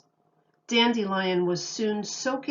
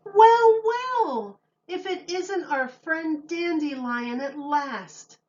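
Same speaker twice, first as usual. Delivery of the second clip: giraffe hostess greeting; 8 semitones higher, 4 dB louder, slower than usual. Acted. Giraffe hostess greets late guest.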